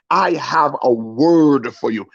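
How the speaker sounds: slow, drawn-out pastor's delivery; pastor looming close. Acted. Pastor closes in on the man with a word, slow and drawn out. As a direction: stretch each word right up in his face. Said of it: "come as close as possible".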